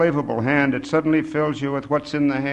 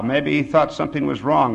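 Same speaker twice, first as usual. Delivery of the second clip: ordinary delivery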